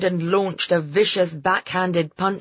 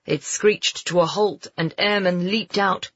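ordinary delivery, nothing marked

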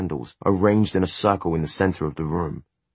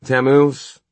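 commanding call; 8 semitones higher, 7 dB louder than usual. Acted. Summoning the demon.

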